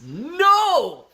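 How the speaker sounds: sudden loud, high-pitched "no"; emphatic shout. Real, not acted. Streamer rejecting the idea, emphatic shout. Mock outcry at kids rule.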